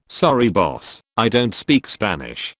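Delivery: ordinary delivery, nothing marked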